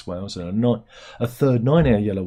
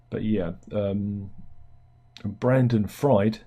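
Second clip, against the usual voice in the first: usual speaking voice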